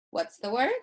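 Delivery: ordinary delivery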